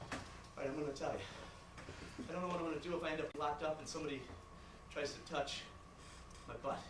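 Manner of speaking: low, soft, halting phrases; hushed, embarrassed admission; reluctant confession. Acted. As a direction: confess it quietly, with awkward pauses. Son makes a hesitant confession.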